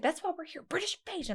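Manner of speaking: imitating insistent mom. A daughter imitating her mom insisting on the show, high and firm. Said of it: "She's like".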